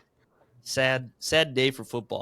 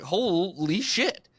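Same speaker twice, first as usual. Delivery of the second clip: slow, drawn-out, higher-pitched disbelief; retold astonishment; 4 semitones higher, much slower than usual. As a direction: stretch it out in mock shock. Retelling his shock at the injury.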